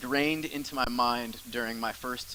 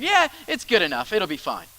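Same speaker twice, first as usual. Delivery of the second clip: lazy inner voice; 5 semitones higher, 4 dB louder than usual. Retold or imitated. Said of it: "Every time I get to that place where".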